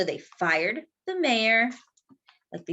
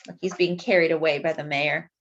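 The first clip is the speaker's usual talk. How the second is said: plain reading voice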